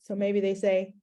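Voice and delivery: ordinary talk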